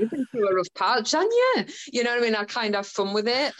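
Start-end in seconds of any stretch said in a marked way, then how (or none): 0.0-1.6 mock sympathy. Teasing zombie teddy bear.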